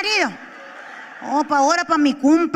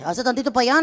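usual speaking voice

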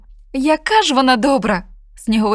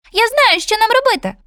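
much higher, louder eager cry; excited bullfinch; 13 semitones higher, 4 dB louder than usual. Acted. Bullfinch excitedly calls out his plan, much higher. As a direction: burst out with the idea. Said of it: "схвильовано вигукнув Снігур".

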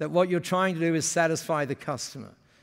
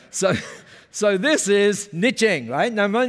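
ordinary talk, nothing marked

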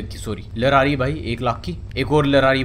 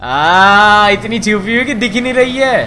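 mocking jab; 5 semitones higher, 8 dB louder, slower than usual. Mocking the hidden car.

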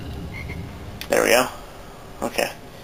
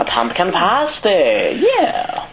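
slow, drawn-out cartoonish echo of the character, then a much higher squeal on yeah; mimicking the game voice; 9 semitones higher, much slower than usual. Gamer parroting the in-game character, playful mock cheer. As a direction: copy the game character's cheer, stretched and silly.

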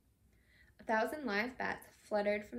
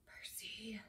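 urgent whisper, much softer; hissed whisper; 10 dB quieter than usual. A narrator's reading. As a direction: hiss a warning under the breath. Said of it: "hissed Ron".

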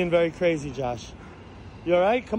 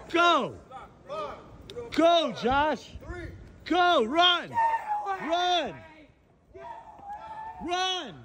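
shouting at him to run; 6 semitones higher, much slower than usual. Acted. Urging friend to flee.

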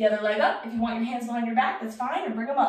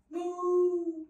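higher, drawn-out moo; cow moo; 5 semitones higher than usual. Pretend. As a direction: give a long cow's moo. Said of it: "morning cow".

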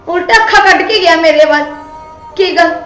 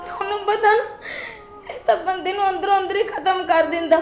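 softer, tearful complaint; 10 dB quieter than usual. Acted. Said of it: "ਰੋ ਨਾ ਤੂੰ।".

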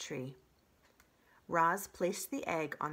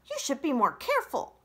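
high, louder scolding fox voice; Fink the fox; 8 semitones higher, 9 dB louder than usual. Acted. Fox scolding the robot for her scrapes, high pitched. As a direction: chide her like a cheeky fox. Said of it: "said Fink".

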